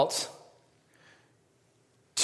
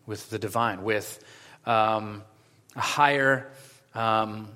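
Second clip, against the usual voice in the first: low, measured praying voice; prayer; 4 semitones lower, 5 dB quieter than usual. Acted. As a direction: pray quietly and reverently, in unhurried phrases. Leads an opening prayer.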